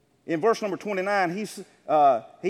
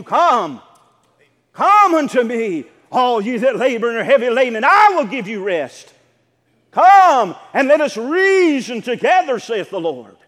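loud, ringing scripture call; preaching at full force; 7 dB louder than usual. Acted. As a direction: cry out the invitation to the congregation.

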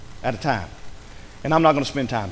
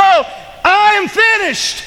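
high, loud, slow shout; preaching shout; 6 dB louder, much slower than usual. Acted. Preacher to congregation, mocking what leaders said, shouting. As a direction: fling the words out at full force.

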